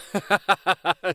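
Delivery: a light laugh; amused little laugh. A narrator's reading. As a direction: laugh at the chaos. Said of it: "she laughed".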